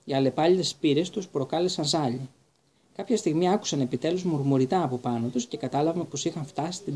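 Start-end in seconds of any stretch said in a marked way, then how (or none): none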